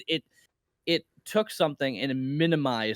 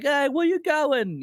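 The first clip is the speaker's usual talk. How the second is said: high, drawn-out British shout; hammed-up British dude, pitched way up; mock British dudes; 11 semitones higher, 4 dB louder, slower than usual. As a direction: call out slowly in a put-on British accent. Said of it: "um, British dudes like".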